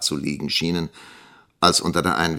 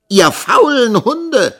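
high, loud, drawn-out insult; angry yell; 10 dB louder, slower than usual. A narrator's reading. Schoolmaster's wife finishing his rebuke of the pupils, yelling. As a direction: yell it slowly and full out. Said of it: "beendete Misses Squeers den Satz".

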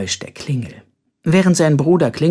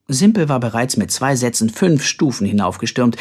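plain reading voice

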